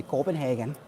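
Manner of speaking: Copenhagen in a put-on accent; quirky pronunciation. Shown as a demonstration. Streamer joking about the place's name, put-on pronunciation of Copenhagen. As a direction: set Copenhagen apart from your conversational voice. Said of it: "regular conversational voice, but every time".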